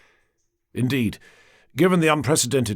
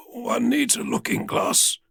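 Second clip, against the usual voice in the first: slow, strained croak; weak king croaking from his sickbed; 11 semitones higher, slower than usual. Acted. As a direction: force the words out of a sore throat. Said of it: "he croaked".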